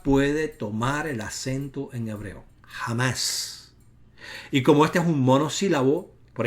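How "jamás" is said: breathy emphatic jamás. Real, not acted. Emphatic close to grammar rule.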